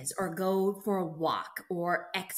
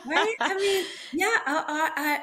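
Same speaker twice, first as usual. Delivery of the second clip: real laughter breaking through the words; laughing; 10 semitones higher, 8 dB louder than usual. Real, not acted. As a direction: laugh it out, high and loud. Laughing at son's correction story.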